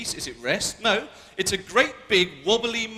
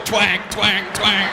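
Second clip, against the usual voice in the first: twanging noise; 6 dB louder, much slower than usual. Pretend. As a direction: twang like a mouth harp.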